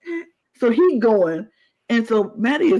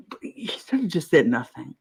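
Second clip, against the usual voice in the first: soft unvoiced whisper; whispered aside; 5 semitones lower, 5 dB quieter than usual. Real, not acted. Fan muttering her verdict on the player, whispered. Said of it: "whispered aside".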